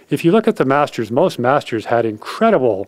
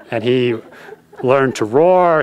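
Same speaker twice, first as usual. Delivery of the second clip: ordinary talk, nothing marked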